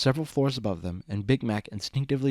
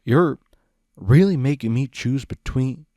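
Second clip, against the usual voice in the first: pleading, on the verge of tears; upset, close to tears; 3 semitones higher, 7 dB louder than usual. Acted. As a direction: voice rising with hurt. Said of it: "Big Mac felt his eyes water".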